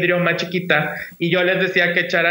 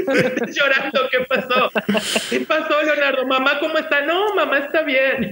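high-pitched, anxious questioning; retold worried mother; 8 semitones higher than usual. Mother asks her crying son what happened, worried. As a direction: imitate a mother's alarm in a raised pitch.

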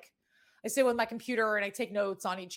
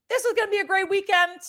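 excited; 10 semitones higher, 7 dB louder than usual. Real, not acted. Hyping the upcoming weekend.

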